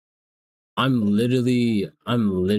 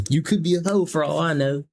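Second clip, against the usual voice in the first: higher-pitched mock Southern accent; 6 semitones higher than usual. Streamer teasing a stranger about how they talk, mocking Southern accent. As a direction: playfully mimic their Southern accent. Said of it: "Yeah, I'm mocking you. I love your accent".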